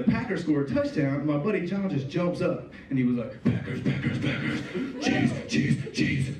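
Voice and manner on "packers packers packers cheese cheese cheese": wild chant with a shrill squeal on cheese; mock cheering. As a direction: chant it like a crazed fan. Friend cheering for touchdown.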